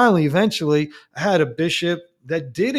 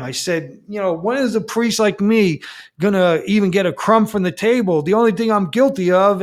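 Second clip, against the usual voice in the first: indignant protest; 6 semitones higher than usual. Retold or imitated. Priest confronting his bishop, indignant and raised. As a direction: plead your case hotly to the bishop.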